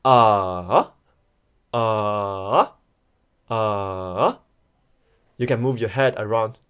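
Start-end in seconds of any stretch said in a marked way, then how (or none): 0.0-4.4 AH dipping low, then rising; long, scooped AH. Shown as a demonstration. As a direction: let each AH sink low and climb back. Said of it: "we can call it a dipping tone".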